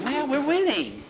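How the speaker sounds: high-pitched, excited retort; gleeful protest. A narrator's reading. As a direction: protest happily to a teammate. Player protests being told off.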